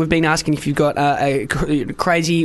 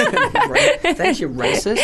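laughing; much slower than usual. Real, not acted. Joking reply, laughing.

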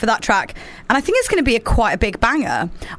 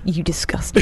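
deadpan disgust; 5 semitones lower than usual. Retold or imitated. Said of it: "they just deadpan you".

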